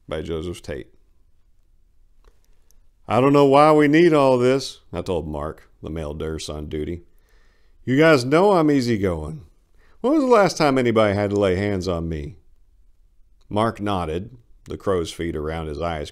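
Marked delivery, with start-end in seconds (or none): 3.1-4.8 raised, loud voice, pushing back hard; loud protest. Acted. Patient objecting to the nurse, loud and indignant. 7.8-12.4 louder and higher, arguing his innocence; loud pleading protest. Acted. Patient insisting to Mark he is easygoing, loudly.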